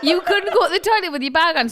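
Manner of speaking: high-pitched words through a laugh; laughing retelling. Real, not acted. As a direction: push the story out while giggling. Retelling while still laughing.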